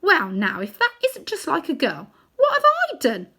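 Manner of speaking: put-on high, loud outraged boy; indignant boy. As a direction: protest your innocence loudly. Diggory indignant.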